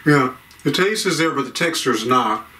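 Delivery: talking with food in mouth; chewing. Real, not acted. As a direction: mouth partly full of cookie. Seen 1: Bites bar at -2.0, lips pursed and working while speaking.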